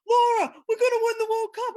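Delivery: high, loud mock cheering of the fans; excited fans. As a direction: belt it out like hyped-up fans. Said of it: "And everyone would go".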